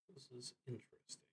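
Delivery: soft and quick, half to himself; muttered to himself. Real, not acted. Player spotting a missed note, muttering quietly to himself. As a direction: mutter it under your breath as you spot something. Noticing a note mid-game.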